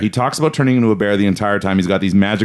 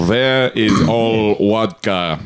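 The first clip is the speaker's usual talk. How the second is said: slow, thick mock Russian accent; slow comic Russian accent, v turned to w; much slower than usual. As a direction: ham up a Russian asking for vodka. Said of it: "man, my Russian accent".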